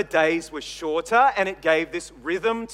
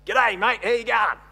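broad ocker Australian greeting, pitched up and louder; hammed-up ocker accent; 4 semitones higher, 4 dB louder than usual. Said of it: "put on a bit of ochre".

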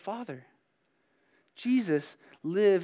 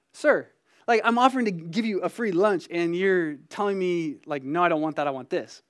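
raised, louder mock-indignant "Sir"; indignant inner protest; 3 semitones higher, 6 dB louder than usual. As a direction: let the outrage build, then crack into a laugh. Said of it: "is what my heart was saying".

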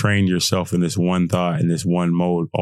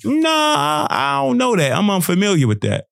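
dismissive brush-off; 11 semitones higher than usual. Retold or imitated. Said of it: "should I be like".

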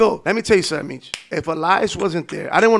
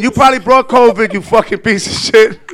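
swearing tirade; 4 semitones higher, 9 dB louder than usual. Retold or imitated. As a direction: curse them out laughing. Mocking Covid fears, cursing.

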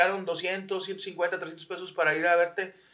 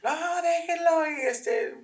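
mock an overprotective parent; hammed-up whiny parent; mocking modern parent; 10 semitones higher than usual.

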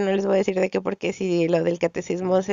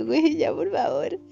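higher, pleading cry to stop; exasperated plea; 6 semitones higher than usual. Retold or imitated. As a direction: beg her to drop it. Stressed, begging to stop.

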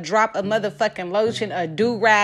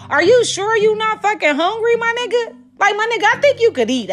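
high, disbelieving mock question; incredulous high mock; 9 semitones higher than usual. Teasing her boyfriend about his growling stomach, high incredulous. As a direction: push it up in comic disbelief.

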